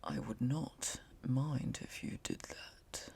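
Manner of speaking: slow, soft, whispered calm voice; unbothered quiet character; slow soft reply. Acted. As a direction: say it slowly and softly. Rakesh replies calmly.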